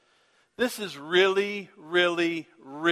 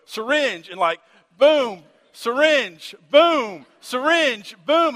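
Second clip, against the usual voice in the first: loud, high punchy bursts; retelling it with rising, exclaimed booms; acted-out rapid-fire action; 6 semitones higher, 6 dB louder, slower than usual. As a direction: punch each word like a blow in a story. Acting out giving syringes of medicine.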